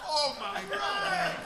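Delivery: very high, slow, laughing cry of delight; elated fan squeal. Retold or imitated. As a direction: squeal like a spectator in the stands. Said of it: "eight people in the stands who are all like".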